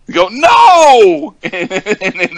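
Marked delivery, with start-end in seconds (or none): 0.3-1.3 very high, loud imitated "no"; annoyed caller. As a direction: cry out in mock exasperation. He really gets them annoyed.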